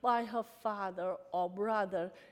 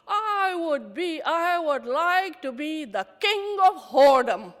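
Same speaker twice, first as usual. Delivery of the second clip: defiant joke; 5 semitones higher, 8 dB louder than usual. Retold or imitated. Answering the anchor's magic wand, loud and proud. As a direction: announce it grandly through tears.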